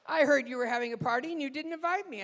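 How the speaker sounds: high, loud, rapid-fire accusation; staged yelling. Acted. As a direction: hurl a mock grievance for the audience. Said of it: "start yelling".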